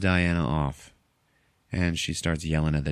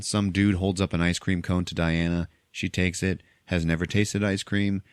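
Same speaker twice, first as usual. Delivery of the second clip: ordinary talk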